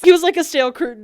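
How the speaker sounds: fast, loud and high; loud punchline. Real, not acted. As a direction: blurt the punchline out.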